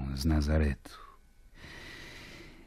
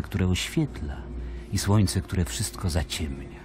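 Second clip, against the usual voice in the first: ordinary talk, nothing marked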